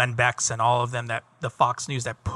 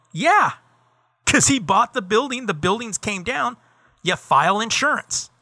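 higher, louder, dripping sarcasm; sarcastic retort; 7 semitones higher, 4 dB louder than usual. Retold or imitated. Host answering a 9/11 truther, louder sarcastic scorn. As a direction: spell out the obvious with scorn.

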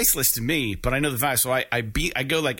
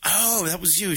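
imitating the clerk; 6 semitones higher than usual. Clerk voiced in his story.

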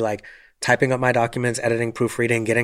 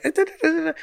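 high, silly mock babble of people laughing; mocking snicker noise; much faster than usual. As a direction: imitate the people behind giggling, way up high. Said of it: "They're like".

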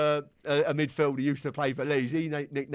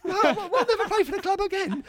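high mocking gaffer voice; 3 dB louder than usual. Repeating gaffer's threat in disbelief.

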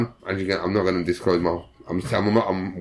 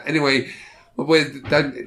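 ordinary delivery

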